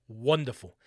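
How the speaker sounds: ordinary talk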